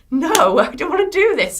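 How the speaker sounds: loud, high retold refusal with a laugh; high-pitched, laughing "no way" protest; mock protest. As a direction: push back loudly, half laughing. Retells refusing management's pop plan.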